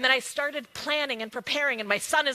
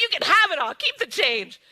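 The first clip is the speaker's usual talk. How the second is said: "Keep the change" thrown out louder and higher; big-hearted keep the change; bold, generous announcement; 4 semitones higher, 7 dB louder than usual. Retold or imitated. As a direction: toss "Keep the change" off like pennies. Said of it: "I'm like".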